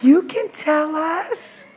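high, slow coaxing; tipsy coaxing. Retold or imitated. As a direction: coax him sweetly, high and slow. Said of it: "she's stroking his shoulder".

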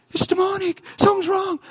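high, panicky mock mother's voice; distressed mother. Preacher voicing a frightened mother about floating toys, high panicky mock alarm. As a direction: sound like a frightened mother pleading for help. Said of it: "mother distressed and freaked out".

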